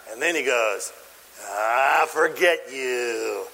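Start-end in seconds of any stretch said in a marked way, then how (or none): 1.4-3.5 mock dismissal. Servant dismisses the plea.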